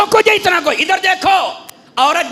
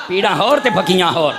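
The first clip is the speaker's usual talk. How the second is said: lower-pitched mock fiery preacher's cry; Khadim Rizvi impression; 9 semitones lower than usual. Preacher jokes to the crowd in a cleric's style, low mock cry. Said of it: "بابے خادم رضوی دی بولی بھی بول لئیے".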